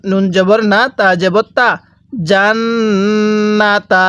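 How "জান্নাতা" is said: slow জান্নাতা with a long hummed double n; gunnah nasal hold. Shown as a demonstration. Teacher shows learners how to read, nasal held জান্নাতা. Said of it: "এখানে আমরা গুনাহ করব".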